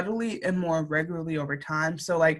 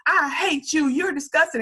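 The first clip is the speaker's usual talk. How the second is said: higher, slow mock Southern bigot; 8 semitones higher, 3 dB louder than usual. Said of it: "like, he's Southern".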